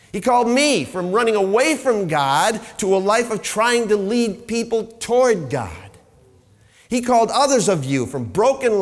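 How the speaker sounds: loud, rising preacher's call; preaching. Acted. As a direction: build it up like a revival sermon. Sermon builds to full force.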